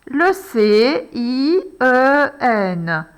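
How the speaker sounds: letters dragged out one at a time; slow spelling. Shown as a demonstration. Teacher spelling for pupils, very slowly. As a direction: spell it slowly as you write. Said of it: "pour aller écrire le I".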